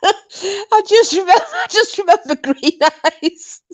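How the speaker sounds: high-pitched, cracking up; real laughter. Real, not acted. Remembering Green Eyes coming and going, laughing. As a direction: squeeze the memory out between laughs. Helpless laughter while reminiscing.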